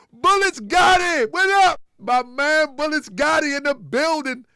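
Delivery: loud, high hype greeting; excited shout-out. Real, not acted. Calling out a friend in chat.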